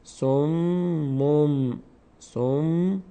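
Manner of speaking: slow سموم, both m sounds held; som... mom, ending سموم on a hummed m; tanvin read as mim. Shown as a demonstration. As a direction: draw out سموم, syllable by syllable, closing on m.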